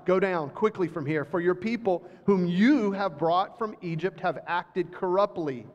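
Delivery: usual speaking voice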